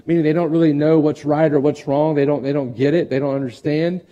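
ordinary delivery

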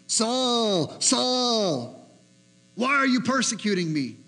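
a hint of a heavenly voice calling. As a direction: call his name from above. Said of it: "I heard a voice saying".

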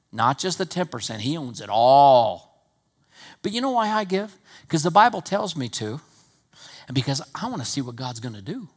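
ordinary delivery

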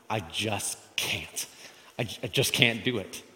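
understated overwhelmed sigh of a line; acted defeat. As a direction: say it as if giving up. Voicing dread before hard thing.

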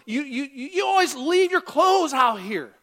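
hammed-up angry yell at a spouse; yelling demo. Shown as a demonstration. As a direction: yell at a spouse over the clothes. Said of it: "That, that's yelling".

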